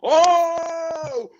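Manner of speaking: high, drawn-out mock holler; obnoxious announcer hooting. Fan mimicking the rival stadium's announcer after a hit, high hooting holler. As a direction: hoot it in long, high held notes like an obnoxious announcer. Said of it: "he's hooting and hollering and screaming".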